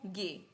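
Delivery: German G named गे, hard g; German letter G. Shown as a demonstration. Teacher showing learners German G, गे with hard g. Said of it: "जी को क्या बोलेंगे?".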